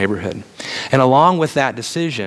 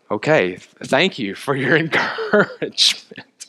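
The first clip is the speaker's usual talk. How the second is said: dry sarcastic thanks with a laugh; wry mock gratitude, slowed down; 3 semitones higher than usual. Sarcastic reply to discouragement.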